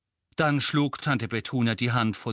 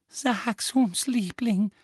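high, coaxing Petunia voice; Aunt Petunia whispering; 11 semitones higher, 4 dB louder, much slower than usual. A narrator's reading. Aunt Petunia coaxes Dudley, mock whisper. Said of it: "flüsterte Tante Petunia".

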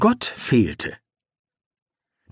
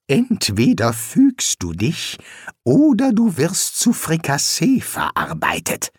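hissing threat; 4 semitones higher than usual. A narrator's reading. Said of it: "zischte er". (zischende Drohung)

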